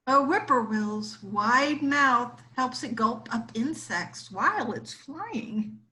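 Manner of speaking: usual speaking voice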